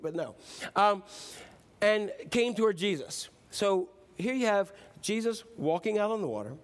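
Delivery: ordinary talk, nothing marked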